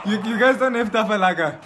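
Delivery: loud, laughing question; barely holding it together on the prank call; laughing disbelief. Real, not acted. Asking the shop on speakerphone, cracking up.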